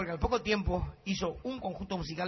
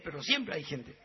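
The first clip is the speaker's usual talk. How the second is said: usual speaking voice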